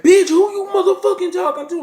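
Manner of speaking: angry retold shout, high and booming; really loud. Boyfriend snapping back at his girlfriend, loud angry yell. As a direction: imitate him blowing up at her, full volume. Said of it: "really got loud with her".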